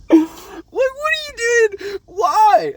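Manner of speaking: high, loud laugh-cry shout; shrieking with laughter at the absurdity; incredulous laughing shout. Real, not acted. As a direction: yelp it in disbelief.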